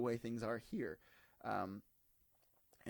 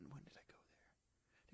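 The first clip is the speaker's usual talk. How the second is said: soft, breathy self-question; whispered aside; 23 dB quieter, faster than usual. Real, not acted. Trying to remember the year, whispered to himself. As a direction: whisper it to yourself.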